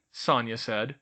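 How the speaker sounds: usual speaking voice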